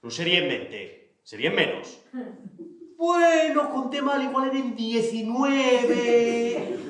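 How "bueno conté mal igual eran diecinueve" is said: corrección aguda. Acted. Xico corrige avergonzado.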